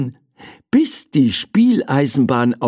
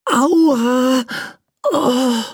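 high drawn-out ouch, then a groan; pained cry; 12 semitones higher than usual.

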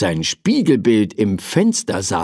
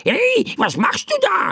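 very high, startled bird squawk; squawking bird cry. A narrator's reading. Bird gets flour poured over its head, squawking. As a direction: squawk it out in shrieky protest. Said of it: "rief der Vogel".

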